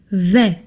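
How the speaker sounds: Vais said as vé, S silent; French pronunciation. Shown as a demonstration. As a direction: clean open vé for Vais, no S. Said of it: "it's pronounced vais".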